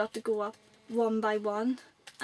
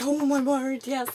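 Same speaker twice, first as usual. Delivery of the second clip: loud, higher gush of delight; excited acceptance; 4 semitones higher, 8 dB louder, slower than usual. Retold or imitated. Fan accepting wrestler's offer to sign, excited. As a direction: reenact a thrilled fan saying yes.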